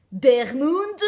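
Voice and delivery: loud, playful proclamation, rising at the end; mock booming exclamation. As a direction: boom out the odd brand name. Playing with an odd brand name. (exclamation tonitruante, pour rire)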